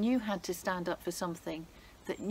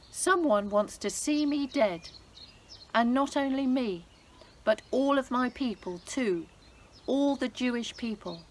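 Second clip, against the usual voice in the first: tearful plea; 4 semitones higher, 5 dB louder than usual. Acted. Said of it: "with tears in her eyes".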